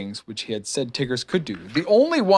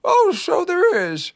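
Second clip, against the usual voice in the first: high, slow voice of wonder; wondering Pooh; slower than usual. A narrator's reading. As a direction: marvel slowly in a high bear voice. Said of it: "looking up wonderingly".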